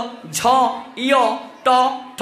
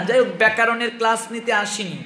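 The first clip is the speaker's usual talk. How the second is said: usual speaking voice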